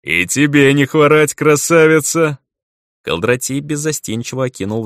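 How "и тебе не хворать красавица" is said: louder, higher, slow troll flirting; hammed-up hearty troll; booming troll greeting. Troll greets elf loudly.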